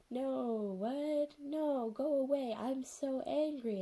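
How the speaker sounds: high, drawn-out mock angry refusal; whiny mock anger. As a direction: imitate an angry girl, dragging words out. Retold character refusing angrily.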